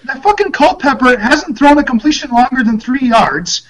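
high, heated mock complaint; imitating grumbling coach. Coach complains to a reporter about his offense, high and heated. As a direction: gripe like a coach whose team is losing.

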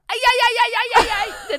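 high loud repeated ouches; yelping ouch. Retold or imitated. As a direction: yelp in pain again and again. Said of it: "Alex bara". (höga skrik aj aj)

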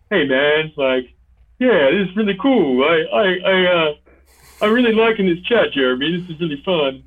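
high, loud, laid-back dude voice; Pugglesworth voice. Shown as a demonstration. Dungeon master showing the Pugglesworth voice to a guest, high and loud. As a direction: go high and loud like an eager easygoing guy. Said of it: "switching from that to Pugglesworth is like".

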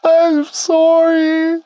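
high, drawn-out sobbing plea; sobbing apology. Acted. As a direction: beg through sobs, very slowly. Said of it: "he kept sobbing and panting".

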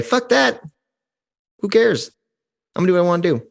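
high, scoffing don't-care voice; reckless defiance. Retold or imitated. The careless wrongdoer brushing off his conscience, high and scoffing. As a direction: toss off the conscience with a sneer. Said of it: "Or do you say".

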